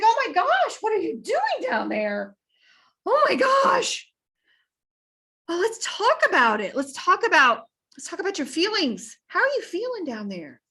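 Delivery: gush, then ask about feelings; gushing counselor. Retold or imitated. Counselor calls down to the man, high and gushing. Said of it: "there's a counselor that walked by".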